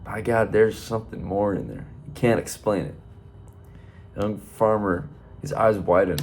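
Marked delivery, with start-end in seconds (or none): none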